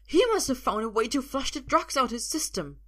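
high, loud panicky whine; whiny, flustered explanation; whining. A narrator's reading. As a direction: make excuses in a high voice. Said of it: "the man whines".

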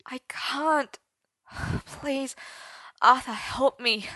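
a high, whispered, broken plea that swells on help me; near tears. A narrator's reading. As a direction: beg him for help, close to tears. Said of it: "nearly crying".

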